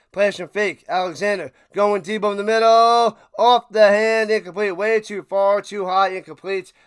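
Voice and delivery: quick live sportscaster call of the pass; excited game call; play-by-play call. Acted.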